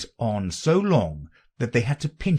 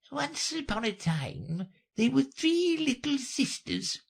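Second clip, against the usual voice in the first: very high, feeble storyteller; tiny sleepy creature starting a tale; hurried Dormouse. A narrator's reading. The Dormouse begins a story for Alice, high and feeble. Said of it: "began in a great hurry".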